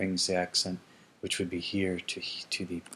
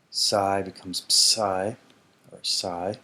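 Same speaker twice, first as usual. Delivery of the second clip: slow letter demo; 3 dB louder, slower than usual. Shown as a demonstration. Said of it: "shows letter combination".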